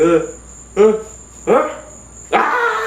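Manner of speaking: short gasped uhs rising into a long high scream; freaking out. Retold or imitated. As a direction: act out panic breaking into a scream. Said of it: "freaking the hell out".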